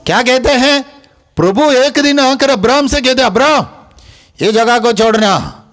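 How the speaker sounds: high-pitched, insistent preaching; high, ringing exhortation; preaching at full force. Real, not acted.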